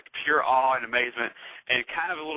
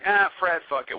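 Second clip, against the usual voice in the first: a louder, higher call to Fred; 6 semitones higher, 5 dB louder than usual. Retold or imitated. The teller calling his friend back at the door, a louder, higher call. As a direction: call him back, loud and pitched up.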